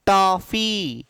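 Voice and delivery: टॉफी slowly, in two parts, open ऑ; open ऑ vowel. Shown as a demonstration. Teacher models टॉफी for students, slow open ऑ. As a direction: stretch the ऑ in टॉफी. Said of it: "अ का उच्चारण करना है".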